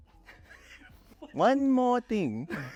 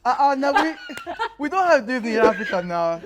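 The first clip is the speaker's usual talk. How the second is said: laughing; 6 semitones higher, 5 dB louder than usual. Real, not acted. Joking denial while laughing.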